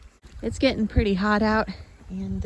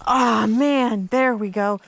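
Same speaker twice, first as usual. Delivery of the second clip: relieved; 9 dB louder, much slower than usual. Real, not acted. Landing the fish.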